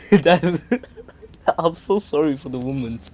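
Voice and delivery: laughing through the words; can't stop laughing while talking. Real, not acted. Laughing at the couple's fight.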